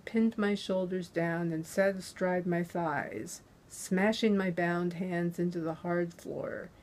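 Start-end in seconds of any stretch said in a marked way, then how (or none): none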